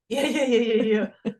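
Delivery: laughing yeahs. Real, not acted. Laughing at herself while telling the Air Force story, real laughter. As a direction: yeah yeah through real laughter.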